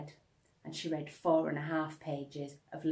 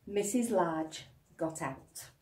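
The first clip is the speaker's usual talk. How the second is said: ordinary delivery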